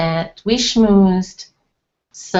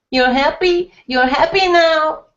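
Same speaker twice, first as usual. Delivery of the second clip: mother's accusing rebuke; 7 semitones higher, 4 dB louder than usual. A narrator's reading. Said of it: "my mother said".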